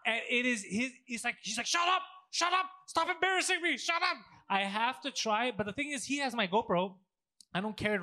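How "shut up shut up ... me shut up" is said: high-pitched, exasperated mock outburst; mock protest. Friend imitates son begging mom to stop, high frantic protest. Acting out embarrassed son's retort.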